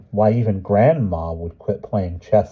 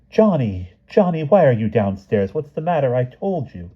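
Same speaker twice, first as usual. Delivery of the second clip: startled mother; 6 semitones higher than usual. A narrator's reading. Mother scolds son at party.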